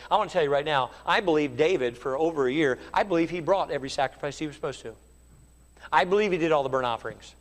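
ordinary talk, nothing marked

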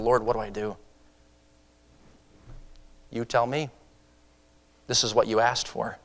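quietly wrestle with the Lord in the dark; hushed prayer. Retold or imitated.

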